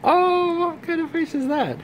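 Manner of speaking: high, soft cooing voice. Real, not acted. Mom coos to baby London.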